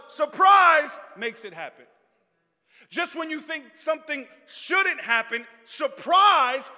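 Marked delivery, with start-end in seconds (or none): 0.1-1.0 drawn-out loud SURPRISE; preaching exclamation. Real, not acted. Preacher's punchline refrain. 5.6-6.7 loud punched SURPRISE; preaching exclamation. Real, not acted.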